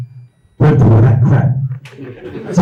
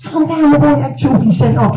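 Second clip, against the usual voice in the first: shout; 13 semitones higher than usual. Retold or imitated. Shouting at player from the back.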